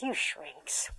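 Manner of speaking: mother scoffing at the therapist; scornful mutter. Retold or imitated. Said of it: "And she said".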